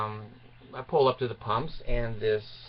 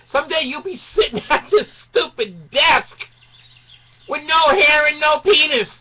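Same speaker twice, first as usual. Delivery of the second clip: high, loud rant; yelling exhortation; 14 semitones higher, 6 dB louder than usual. Real, not acted. Comic shouting at the young audience, rant.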